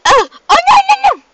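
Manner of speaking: very high, loud cries of alarm; panicked shriek. Real, not acted. Player in trouble in the game, panicked shriek. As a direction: shriek out rapid frightened cries.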